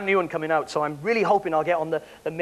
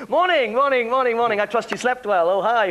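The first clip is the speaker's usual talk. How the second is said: raised, chirpy entertainments-officer hello; perky greeting; 4 semitones higher, 3 dB louder than usual. Acted. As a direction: greet passengers with hammed-up perkiness. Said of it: "you gotta keep perky all the time".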